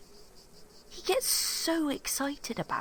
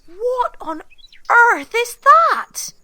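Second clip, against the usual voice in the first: loud and higher, dragging out earth slowly; shocked; 9 semitones higher, 15 dB louder, slower than usual. Acted. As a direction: recoil in loud disbelief. Nina shocked by the dead seagull.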